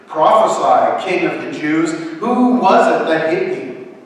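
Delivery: taunting. A narrator's reading. Soldiers jeer at Jesus as they slap him, taunting. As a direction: taunt a helpless prisoner. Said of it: "said tauntingly".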